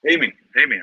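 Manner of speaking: calling out. Real, not acted. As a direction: call out to someone across the space. Calling out to Amy for help.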